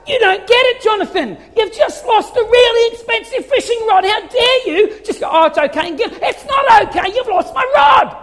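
high, loud raging rant with laughs; dad yelling, laughing at his own rage; angry again. Retold or imitated. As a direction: rant at the boy, half laughing at yourself. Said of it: "I got angry again".

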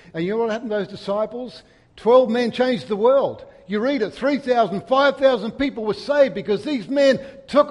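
loud, high, building proclamation; preaching climax. Acted. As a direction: build it up to a rousing peak.

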